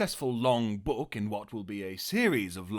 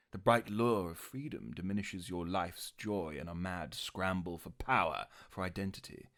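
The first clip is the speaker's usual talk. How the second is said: low, soft menacing villain; Loki impression; 5 semitones lower, 6 dB quieter than usual. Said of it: "You know that voice".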